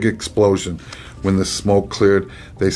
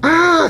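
sudden high startled scream; 4 dB louder than usual. A narrator's reading. Son screaming as his father turns into a dragon. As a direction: shriek at the sight. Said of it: "screamed Radius".

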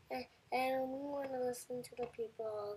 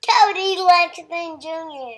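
shouting; 4 semitones higher, 20 dB louder than usual. Real, not acted. Child shouts name into mic.

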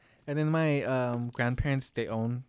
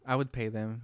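normal talking voice

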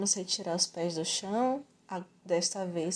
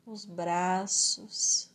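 guided relaxation; slower than usual. Acted. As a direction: slowly talk the body into rest.

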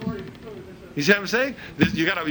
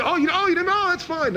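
friend brushing it off; 14 semitones higher than usual. Retold or imitated.

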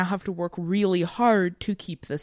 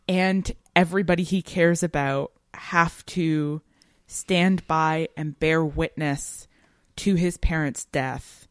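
ordinary talk

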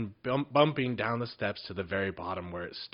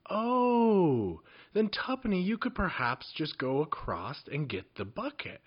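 coaxing goblin; 7 semitones higher than usual. A narrator's reading.

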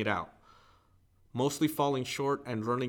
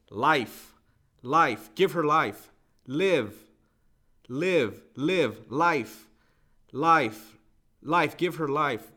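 loud, broken repeated plea, reliving it; a retold prayer, loud and desperate; desperate pleading cry; 3 semitones higher, 5 dB louder, much slower than usual. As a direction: cry out the words again and again as if begging for her life. Desperate prayer at wife's deathbed.

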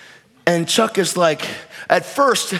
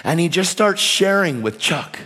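usual speaking voice

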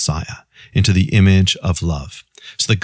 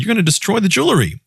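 much higher and faster alarm; panicked protest; 11 semitones higher, faster than usual. Retold or imitated. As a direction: blurt it out in a rush of fear. Woman panics again.

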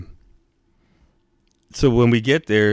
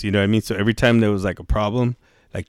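ordinary talk

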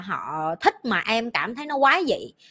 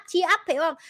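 usual speaking voice

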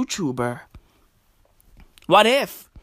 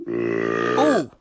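very low mock groan; deep ooh.

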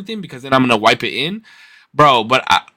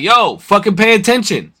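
higher-pitched call to a driver; retold annoyed call-out; 8 semitones higher than usual. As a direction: call out as if scolding a distracted driver. Retells yelling at a distracted driver.

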